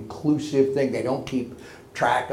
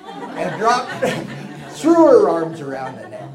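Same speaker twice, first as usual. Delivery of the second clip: laughing; 3 semitones higher, 7 dB louder than usual. Real, not acted. Laughs at touching ending.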